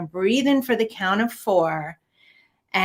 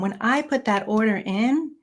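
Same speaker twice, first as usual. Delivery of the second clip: normal talking voice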